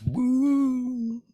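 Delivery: high exultant whoop; sudden joyful whoop. Real, not acted. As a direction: whoop with delight. Spirit released, celebrating.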